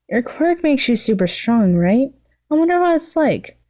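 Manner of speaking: rolling her eyes at her friend; Mimicus's voice.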